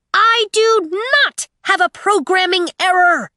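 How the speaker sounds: high, loud indignant robot; irate screech. Acted. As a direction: screech it like an offended android. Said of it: "Aiko's irate voice screeched".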